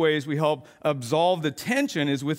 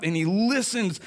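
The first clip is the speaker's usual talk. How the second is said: normal talking voice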